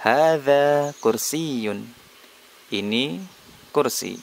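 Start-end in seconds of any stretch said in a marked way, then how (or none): 0.0-1.9 slow, careful Arabic, hadza stressed; slow model phrase. Shown as a demonstration. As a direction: recite it slowly for pupils to repeat. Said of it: "Hadza, ini".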